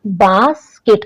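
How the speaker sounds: "बास्केट" split into two beats, bas, then ket; broken into syllables. Shown as a demonstration. Teacher showing students where the word breaks, "बास्केट" said syllable by syllable. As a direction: pause between the two halves of "बास्केट". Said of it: "जहां पर word टूट रहा है".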